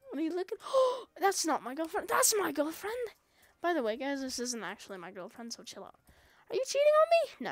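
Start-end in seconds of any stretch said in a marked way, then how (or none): 6.5-7.5 high, fast mock accusation; jealous mock voice. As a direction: squeak it like a jealous partner. Voicing jealous partner.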